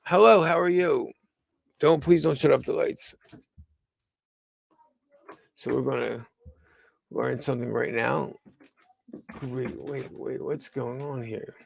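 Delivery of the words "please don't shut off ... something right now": usual speaking voice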